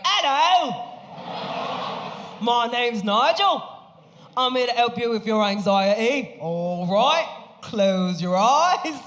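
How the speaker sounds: high, slow, chirpy Cockney meditation guide; Cockney accent. Retold or imitated. Comic imitates Nigel the Cockney meditation guide, high and slow. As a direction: play a Cockney guy leading a calm meditation. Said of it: "had a Cockney British accent".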